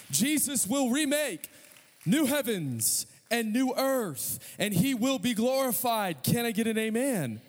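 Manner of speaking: high, driving sermon build; rally the congregation with rising conviction; fervent, high-pitched victory proclamation; impassioned preaching. Real, not acted. Preaches at full force.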